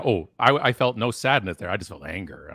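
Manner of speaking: ordinary delivery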